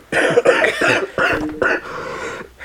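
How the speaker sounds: harsh hacking cough; coughing fit. Real, not acted. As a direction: cough it out hard. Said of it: "One sec, let me cough".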